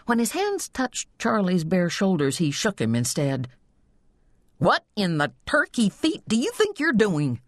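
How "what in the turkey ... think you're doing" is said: higher-pitched exasperated scolding; indignant, pitch raised; angry scolding. A narrator's reading. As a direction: scold a kid brother after a scare. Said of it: "he forgot to be angry".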